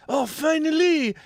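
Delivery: imitating excited relief, pitched high. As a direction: act out his long-awaited delight. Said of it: "He's excited".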